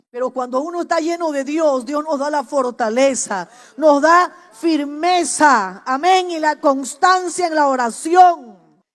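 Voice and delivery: sermon at full force; preaching. Acted. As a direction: proclaim it to the church. Preaching to the congregation.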